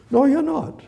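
very high mock agreement; high-pitched teasing answer; comic high reply. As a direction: echo it in a high, amused voice. Voicing a comic answer to Pilate.

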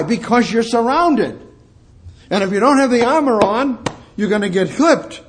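high, loud fervent preaching; preaching at full force. Acted. Preacher warning the church they are surrounded, high and loud. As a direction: lift the room, press every line. Preacher pressing point on armor.

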